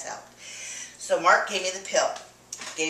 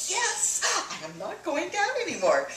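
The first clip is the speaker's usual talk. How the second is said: much higher, bright declaration; excited insistence; 12 semitones higher than usual. Retold or imitated. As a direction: announce it with full joy. Answering husband's "Really?.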